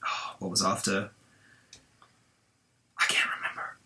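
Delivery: muttered under the breath, very soft; whispering. Real, not acted. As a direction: trail off to a whisper. Trailing off to herself.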